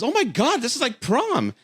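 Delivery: a date squealing that it feels like prom; imitating his date. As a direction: play her up as starstruck. Mimicking date's amazed reaction.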